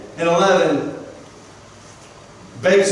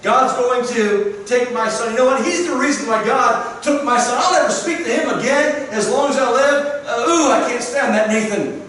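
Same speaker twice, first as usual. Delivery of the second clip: indignant rant; 4 semitones higher than usual. Pretend. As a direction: voice a bitter, sulking king who refuses to forgive. Preacher voices an imagined angry David.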